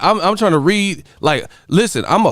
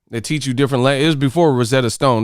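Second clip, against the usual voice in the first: ordinary talk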